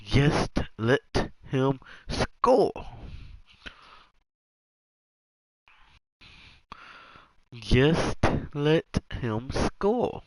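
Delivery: slow, coaxing repeat to himself; slow drawn-out plea. Real, not acted. Repeats the line, slowing down.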